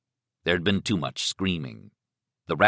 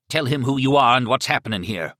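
loud, raised-pitch command; barked order; 6 semitones higher, 5 dB louder than usual. Acted. Killer orders captive to speak, barking. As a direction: bark it at a captive. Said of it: "he barked at Miriam".

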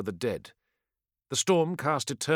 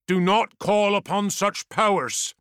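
stern warlord; 7 semitones higher, 4 dB louder than usual. Acted. Warlord warning the sorcerer.

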